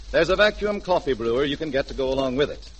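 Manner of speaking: ordinary talk, nothing marked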